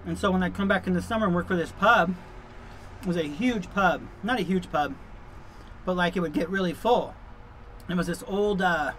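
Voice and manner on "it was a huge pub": ordinary talk, nothing marked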